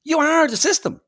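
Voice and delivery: mock confrontation. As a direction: point the finger and call him out. Acting out what Ben should say.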